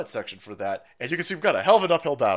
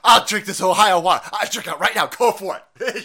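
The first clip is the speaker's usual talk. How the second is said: mock defiant; 3 semitones higher, 8 dB louder than usual. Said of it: "you show us".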